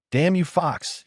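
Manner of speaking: angry shout. A narrator's reading. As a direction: spit the curse out in anger. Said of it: "he shouted inside of his mind".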